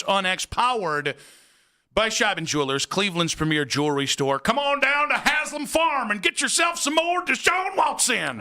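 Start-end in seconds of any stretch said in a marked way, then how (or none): 4.4-8.4 a fake Southern farm ad, yelled; mock Southern accent. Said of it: "I have just kind of a nebulous Southern accent".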